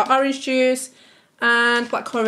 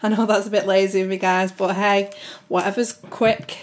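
usual speaking voice

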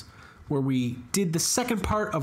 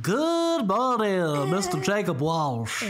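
slow drawn-out announcer greeting; hammed-up slow welcome; mock grand greeting; 4 semitones higher, much slower than usual. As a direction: stretch it out like a pompous host.

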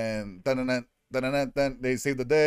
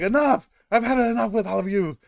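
shouting much higher and louder; furious roar of a fed-up giant; Hulk yell; 8 semitones higher, 4 dB louder than usual. Retold or imitated. Hulk yelling at the whole group, furious shout. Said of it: "he was all like".

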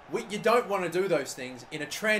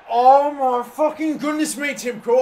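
loud, slow, higher disbelieving rant; exasperated outburst; 6 semitones higher, 10 dB louder, slower than usual. Real, not acted. Player raging at his goalkeeper, slow exasperated outburst. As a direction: drag it out in furious disbelief. Keeper concedes another goal.

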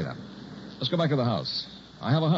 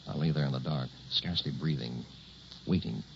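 hushed tension; 5 semitones lower, 7 dB quieter than usual. A narrator's reading. Narrator lying tense in dark.

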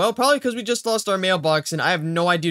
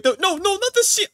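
very high, alarmed no-no; panicked cry; 13 semitones higher, 3 dB louder than usual. Real, not acted. Gamer watching the boat hit the sea, panicked cry. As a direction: cry out as disaster looms.

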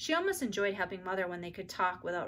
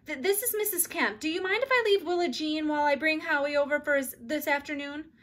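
a hint of a rushed neighbor, higher; hurried voice; 7 semitones higher, 5 dB louder than usual. A narrator's reading. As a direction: hurry through it like a busy mom. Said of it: "a hurried voice said".

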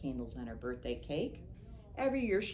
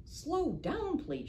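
high, startled little cry; a tiny fish calling out to slow down; goldfish shouting; 12 semitones higher, much slower than usual. Acted. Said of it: "shouted the goldfish".

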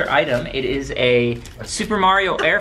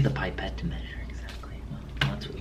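fast whispered aside; 8 semitones lower, 12 dB quieter than usual. Real, not acted. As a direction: hurry it out under your breath. Quick aside while friends argue.

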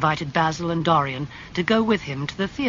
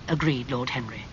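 plain reading voice

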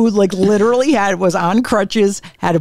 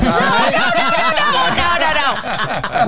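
real laughter through slow repeated protests; laughing denial; much slower than usual. Real, not acted. Comic waving off a guess at the name, laughing protest. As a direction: start very high, laughing, then settle down.

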